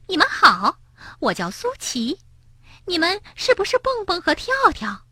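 high, quick chattering hare; shrill Suqi. Acted. Suqi introducing himself to the friends, shrill. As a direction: chatter eagerly in a piping voice. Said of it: "尖声尖气的声音".

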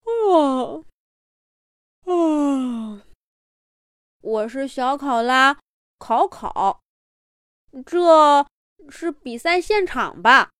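drowsy, yawning little koala; sleepy koala voice. Acted. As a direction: wake up slowly, a bit puzzled. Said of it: "看着工作人员问道". (迷迷糊糊的小考拉)

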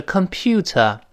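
"computer" in British English, crisp t, no final r; British pronunciation. Shown as a demonstration. Teacher contrasting accents for learners, British pronunciation. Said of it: "在英式发音是computer".

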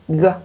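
"G" as a short "ge" with no puff; unaspirated G alone. Shown as a demonstration. Teacher reading each sound separately, "G" without any puff of air. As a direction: hold the air back on "G". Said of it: "它区别很大".

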